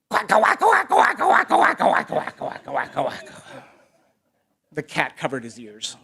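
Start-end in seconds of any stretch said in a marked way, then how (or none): 0.1-3.3 loud, high quacks fading down; shouted duck quacking. A narrator's reading. Duck demonstrates crowing from coop top, loud quacking. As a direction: quack frantically, then wind down. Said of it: "she shouted".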